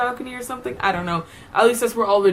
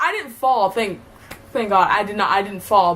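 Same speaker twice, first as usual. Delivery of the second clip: ordinary talk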